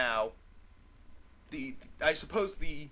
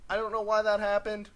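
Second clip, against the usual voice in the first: ordinary talk, nothing marked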